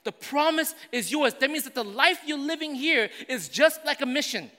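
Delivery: high, loud, driving preacher voice; loud sermon delivery building through the list; full-force preaching. Acted. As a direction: preach it out to the whole congregation. Preaching to the church.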